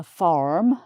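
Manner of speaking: "farm" in an American accent with the R sounded; put-on American "farm" with rhotic R. Shown as a demonstration. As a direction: demonstrate American "farm", strong R before the M. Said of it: "an American might say".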